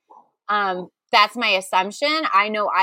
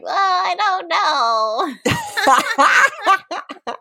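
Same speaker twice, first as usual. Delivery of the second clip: 1 clueless listener; 8 semitones higher, much slower than usual. Pretend. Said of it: "I'm gonna be sitting here like".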